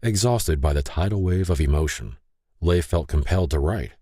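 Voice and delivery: usual speaking voice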